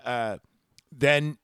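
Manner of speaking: ordinary talk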